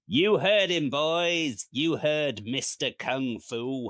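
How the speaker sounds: loud, high, hammed-up jeering call; gang leader. Gang leader rallying his boys, loud mock bravado. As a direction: call out to the gang, slowing on the last words. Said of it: "the leader of the gang says".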